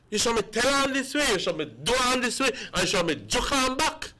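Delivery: high, rapid-fire goading; hot-headed mimicry. Acting out hot-headed supporters.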